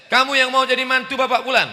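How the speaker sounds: high, loud, fast grilling of the suitor; stern examiner. Acted. Examiner questioning a would-be son-in-law, high and loud. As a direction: bark the question down at the young man. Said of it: "maka Ustadz Somad yang akan ngetes".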